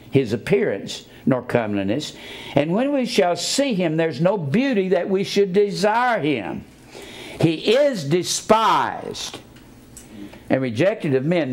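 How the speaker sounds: solemn reading from Isaiah; scripture reading. Acted.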